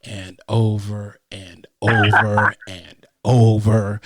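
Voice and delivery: slow, dragged-out repetition; drawn out. Real, not acted. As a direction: stretch every over out. Mocking endless repeated arguments.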